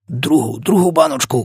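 excited cry. A narrator's reading. Giant calls for a second jar, excited urgent cry. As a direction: pitch leaping, hurry the helper. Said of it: "крикнув він".

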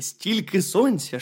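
ordinary delivery

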